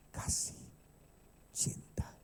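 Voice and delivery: whispered, barely voiced key words; hushed emphasis. Real, not acted. Preacher to congregation, hushed reverent emphasis.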